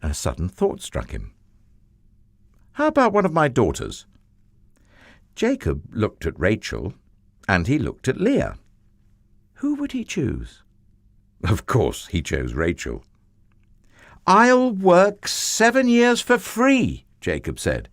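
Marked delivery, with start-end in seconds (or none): 2.7-4.1 quick, high and bright-eyed; eager idea. Acted. Jacob suddenly asking his uncle for a daughter, quick and high. 14.3-17.0 high, loud, slow and earnest; eager Jacob. Acted. Jacob offering his uncle seven years of work, high and earnest.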